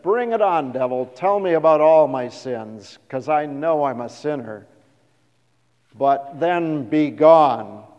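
loud, bold defiance hurled at the devil; defiant loud challenge. Retold or imitated. Luther's loud taunt to the devil, defiant. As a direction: dare him loudly, slowing the last words. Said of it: "He said".

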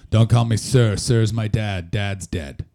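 put-on loud, low, blunt old farmer; gruff farmer. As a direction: bark it like a grumpy farmer. Gruff farmer voiced in story.

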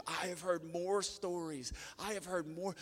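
put-on high, drawn-out, marvelling girl's voice; imitated amazement. Wedding guest recognising the wild pastor's son, imitated amazement. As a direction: stretch it out in mock astonishment.

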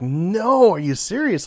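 loud, high disbelieving outburst; shocked. Retold or imitated. Reader shocked by the comic twist, loud disbelief. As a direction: blurt it out in disbelief. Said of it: "I was like".

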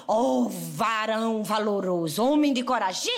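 low, slow, grand voice of God; solemn divine voice. Acted. The Lord hailing a hiding Gideon, low and slow. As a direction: proclaim it slowly like the Lord himself.

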